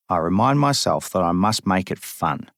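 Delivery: ordinary delivery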